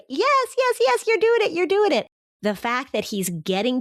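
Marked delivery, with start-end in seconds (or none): 0.1-2.1 excited cheer. Real, not acted. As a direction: cheer the mom on with real delight. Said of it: "I just wanted to say".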